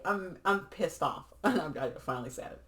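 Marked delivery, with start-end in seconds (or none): 0.0-1.2 higher, slow mock anger; half-playful imitation of a fed-up person; mock angry. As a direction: act out being pissed off, hammed-up. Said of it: "you're freaking mad".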